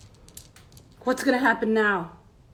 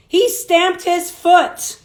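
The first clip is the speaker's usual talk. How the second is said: bright, louder storybook narration; reading to children; 7 semitones higher, 10 dB louder, much slower than usual. A narrator's reading. Teacher reading a picture book to children, lively storybook voice. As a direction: read it out big for the kids.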